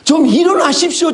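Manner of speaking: high, loud cry to wake him; frantic wake-up call; desperate plea. Acted. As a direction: shout to a sleeping master in panic. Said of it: "흔들면서 깨웁니다".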